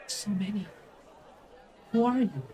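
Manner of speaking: put-on high-pitched little kid in wonder; awed small child. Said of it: "as the child just goes".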